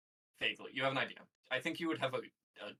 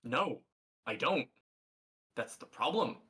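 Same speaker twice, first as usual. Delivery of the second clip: louder, punchy exasperated replies; 2 semitones higher, 5 dB louder, slower than usual. Acted. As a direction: hit each short phrase hard, as a fed-up captive. Exasperated captive answering the players.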